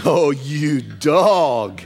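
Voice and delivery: slow, playful "oh, you dog"; teasing. Retold or imitated. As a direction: tease her fondly, drawn out. Jesus as playful teaser.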